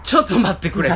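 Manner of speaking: 高く大きく笑い混じりに; 混乱して笑いながら止める; 笑いながらの制止. Retold or imitated. 混乱した自分の反応. (笑い混じりで慌てて止める声)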